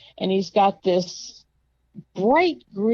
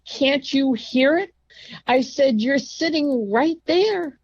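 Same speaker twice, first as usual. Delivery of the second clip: higher, sharp scolding; exasperated; 6 semitones higher than usual. Retold or imitated. Patient scolding the nurse about the ringing bell. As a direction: tell him off for ignoring the bell.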